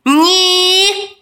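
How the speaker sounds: high, loud, drawn-out squeal; shrieked stubborn refusal; refusing imp. A narrator's reading. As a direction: refuse like a bratty little devil.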